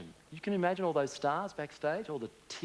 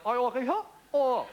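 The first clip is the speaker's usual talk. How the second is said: imitating the Queen; 5 semitones higher, 4 dB louder than usual. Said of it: "she goes".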